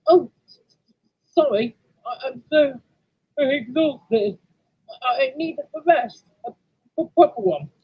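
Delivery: high, stammering apology; flustered, exhausted engine. Acted. Tired engine begs driver for rest, flustered.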